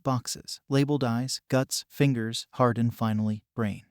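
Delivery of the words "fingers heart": ordinary delivery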